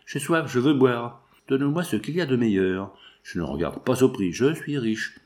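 ordinary delivery

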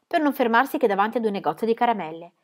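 plain reading voice

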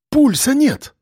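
high-pitched urgent exclamation; urgent announcement. A narrator's reading. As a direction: call it out to convince them. Doctor announces death to crowd.

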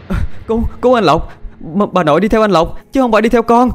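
voice cracking with fear. Acted. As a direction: gasp it out in terror. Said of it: "giọng lạc cả đi vì hoảng sợ". (giọng bé gái lạc đi vì hoảng sợ)

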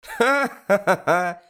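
high, slow owl's guffaw; loud high "ha-ha" belly laugh; loud hearty laughter. A narrator's reading. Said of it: "начала громко и искренне смеяться сова". (громкий искренний смех совы)

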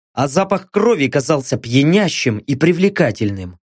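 plain reading voice

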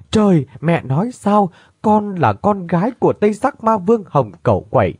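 a daughter hearing her father is the demon king, crying out in shock. Acted. Daughter learns her true father. (giọng cao, sững sờ kinh ngạc)

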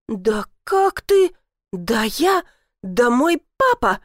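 slow, broken indignant starts; spluttering outrage. A narrator's reading. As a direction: begin a threat and keep breaking off. Outraged, unfinished threats. (захлёбываясь возмущением)

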